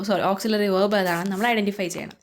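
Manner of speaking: ordinary talk, nothing marked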